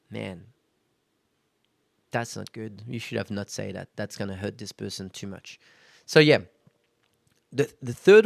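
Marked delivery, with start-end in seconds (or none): 0.1-5.6 softer, lower self-reproach; a hushed note of regret; quiet inner voice. Retold or imitated. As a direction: say it under your breath to yourself.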